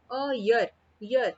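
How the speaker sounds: "year" opened with a clear य glide; y-sound year. Shown as a demonstration. Teacher showing why a is used, "year" starting with य. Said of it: "य की sound आ रही है".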